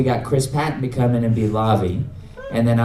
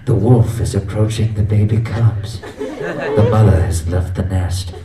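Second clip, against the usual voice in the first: nature documentary narrator; 5 semitones lower than usual. Retold or imitated. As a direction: parody a grave nature-channel voice-over. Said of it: "when you're watching the Nature Channel".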